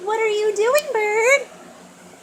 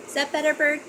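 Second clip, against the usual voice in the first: usual speaking voice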